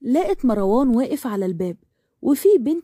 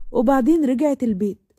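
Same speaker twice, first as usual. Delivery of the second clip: usual speaking voice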